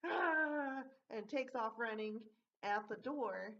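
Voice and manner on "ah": a short, louder, higher yell; robber's mock yell. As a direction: bark it out like the fleeing robber. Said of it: "then he's like".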